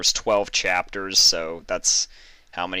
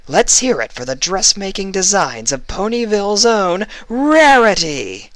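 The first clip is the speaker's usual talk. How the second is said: higher, louder grand announcer building to a huge finish; showman announcer; 7 semitones higher, 7 dB louder than usual. Acted. Announcer introducing the designer to the crowd, grand and building. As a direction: sell the big reveal like a stage host. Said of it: "A smooth voice rang out over the stage".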